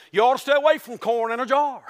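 playful country idiom with a laugh; Southern folk saying. Shown as a demonstration. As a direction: say it like an old Appalachian proverb. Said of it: "if I said it in our acronym".

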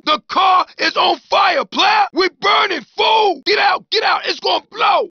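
high, loud alarmed yelling; panicked shout. Acted. Passenger yelling to get out of the burning car, panicked shout. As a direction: scream the warning to get everyone out.

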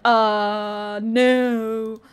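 low, long-held answer; drawn-out reluctant verdict. Pretend. Answering a personality quiz, low and drawn-out. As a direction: stretch both sounds out low.

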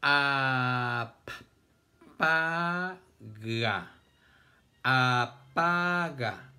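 "Apaga" loud and drawn out, a... pa... ga; syllable by syllable. Shown as a demonstration. Teacher introducing apaga to the class, syllable by syllable. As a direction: stretch each syllable of "Apaga" slowly and firmly. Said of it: "¿Cuántas sílabas tiene la palabra apaga?".

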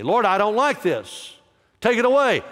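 high, loud begging cry; pleading with God. Acted. Paul begging the Lord to remove the thorn, high and loud. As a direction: beg the Lord to take it away.

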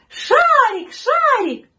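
very high, loud calling; shrieky kids' chant, high and loud; children shouting. A narrator's reading. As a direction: call out like running children. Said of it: "Дети бежали и кричали".

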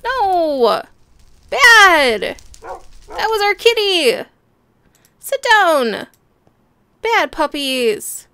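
loud, high telling-off of the dogs; furious owner scolding her dogs; scolding shout. Real, not acted. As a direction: bark orders at naughty puppies.

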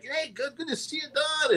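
high, soft imitation of Whitey greeting Don; lightly voiced friendly Whitey; Whitey Ford. Whitey greets Don in the limo, high and soft. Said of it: "and he's like".

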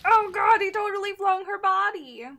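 loud, high, startled outcry; real shock at a gory scene; shocked yelling. Real, not acted. Shocked reaction to game scene.

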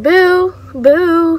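loud high mock booing. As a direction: boo them loudly. Booing at staring cars.

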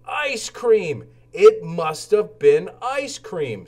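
delighted by the idea of ice cream; excited. Acted. Lowly thinks of ice cream.

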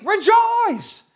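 high, loud shout of rejoice; joyful shout. Real, not acted. Preacher leading the church in a shouted rejoice. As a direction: belt it out for the crowd. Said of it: "say with me".

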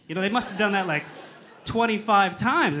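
ordinary talk

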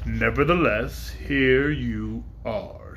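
great dragon passing judgement on Woot. Acted. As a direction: weigh each word like a ponderous beast.

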